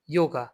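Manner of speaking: ইয়োগা with an initial y sound; teacher's example word ইয়োগা, opening on a y-glide. Shown as a demonstration. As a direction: model the German J as y in ইয়োগা. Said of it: "ওয়াইয়ের মতোই হবে".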